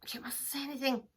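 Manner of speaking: ordinary delivery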